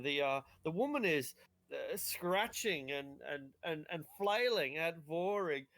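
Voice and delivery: ordinary delivery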